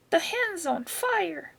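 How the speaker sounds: grandma yelling. A narrator's reading. Grandma on the roof seeing the hen burn, alarmed cry. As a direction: cry out the alarm, high and drawn out. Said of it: "Grandma yelled".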